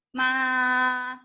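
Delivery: MA held long and level; MA on one steady pitch, no movement; first tone. Shown as a demonstration. Teacher repeats first tone for students, long and flat. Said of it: "this is the first tone".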